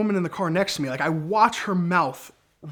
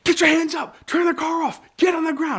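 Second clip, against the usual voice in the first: much higher, louder barked police commands; screaming orders; 13 semitones higher, 4 dB louder than usual. Retold or imitated. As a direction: yell it like a cop drawing his gun. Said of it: "starts screaming at me".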